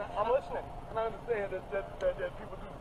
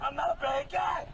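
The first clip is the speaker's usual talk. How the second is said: desperate shout; 5 semitones higher than usual. Real, not acted. Pleading while forced into car.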